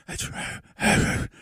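whispered parody of a host; radio host whispering, mocked. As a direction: breathe it out in a hush. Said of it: "radio host go".

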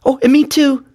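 put-on very high little-girl voice; Rivkala piping up. A narrator's reading. Daughter joins brother offering to help, piping up high. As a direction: chime in eagerly, high as a small child. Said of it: "said Rivkala".

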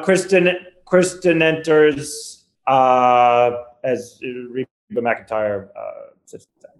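slowing, fading lightly voiced Lorne, trailing off to a near-silent sits down; Lorne losing steam. Lorne reading a late sketch, fading into bored near silence. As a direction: let the energy drain out by the end of the line. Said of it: "kinda summarizes it towards the end".

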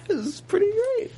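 mock falsetto; high squeaky voice. Joking in a squeaky voice after the scream.

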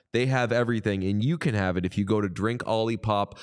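ordinary talk

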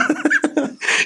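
real high laugh. Real, not acted. Laughing at mother's reaction.

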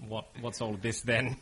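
lightly hammed British copper line; a hint of a British accent, imitating himself hitting on girls; terrible British accent. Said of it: "I had this terrible British accent".

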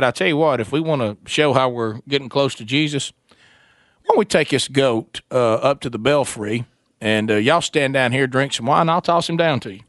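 low, easygoing voice of the schemer; imagined villager. Retold or imitated. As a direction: lay out the idea in a low voice. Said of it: "Who was the person that said".

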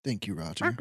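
soft low duck-like voice. Pretend. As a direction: quietly voice a little duck. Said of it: "kind of a good impression of a duck".

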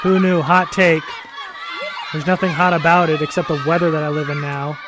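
Kyle Posey impression. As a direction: ham up a rival host yelling his show intro. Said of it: "this is what his podcast sounds like now".

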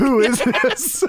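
slow, high, amused who is this; mock baffled. Podcast host mock-asking about the masked wrestler, amused and slow. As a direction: play dumb about who just walked in.

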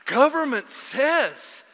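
sarcastic preaching. Acted. Pastor mocking straight-A obedience, loud sarcastic preaching. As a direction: shout the praise with scorn.

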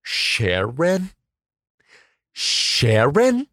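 slow disbelieving Sharon; incredulous. Acted. Teacher mocking the misnamed Cher, disbelieving. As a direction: say it like you cannot believe it.

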